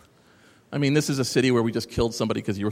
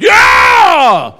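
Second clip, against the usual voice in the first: loud high mock cheer; sarcastic whoop; 14 semitones higher, 18 dB louder than usual. Shown as a demonstration. Showing the audience a sarcastic cheer, loud whoop. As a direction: whoop it up like a stadium. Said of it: "you gotta whoop it up".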